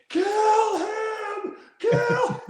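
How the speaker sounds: very high, loud mock shout; hammed-up grandma screaming for blood; bloodthirsty grandma yell. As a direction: scream it from the stands. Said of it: "Grandma would go one eighty".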